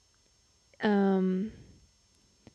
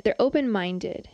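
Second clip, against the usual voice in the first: ordinary talk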